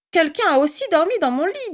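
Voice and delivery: storybook mama bear, soft; Mama Bear's soft voice. A narrator's reading. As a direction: ask softly like a bear mother. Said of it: "dit Maman Ours de sa voix douce".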